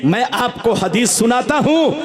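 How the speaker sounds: ordinary talk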